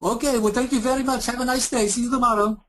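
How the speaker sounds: very high, cheery put-on Eastern European accent; mock agent signing off brightly in an Eastern European accent; hammed-up Eastern European accent, very high; bad Eastern European accent. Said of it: "really bad Indian and Eastern European accent".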